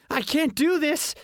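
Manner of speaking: put-on high, panicky squeak of a twelve-year-old; a terrified boy crying out high; squeaky scared kid. A narrator's reading. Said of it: "a squeaky voice echoed".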